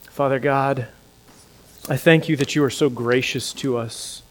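soft, hushed prayer. Acted. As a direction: pray quietly to God. Closing prayer.

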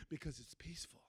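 hushed whisper on the peace; soft whispered aside. Acted. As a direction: breathe it out like a secret. Describing peaceful fishing, unvoiced.

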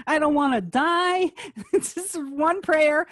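high, laughing mock fear; giggling imitation of a scared friend; laughing mockery. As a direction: tease them through a laugh.

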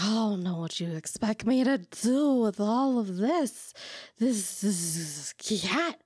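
put-on slow, slurred drunk lady; drunk person voice. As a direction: drag each word out drunkenly. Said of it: "your drunk person voice".

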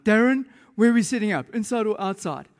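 high, loud, insistent question; urgent calling out. Retold or imitated. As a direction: call out to the groom for an answer. Worship team pestering the groom.

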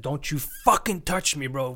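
a sharp, loud warning; retold snap at a man who grabbed his shoulder; snapping back. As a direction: snap it like he just crossed the line. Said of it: "I just snapped".

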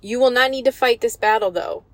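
rising preacher's call to action; preaching at full force. Acted. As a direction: drive the point home to the church. Preacher exhorts the congregation.

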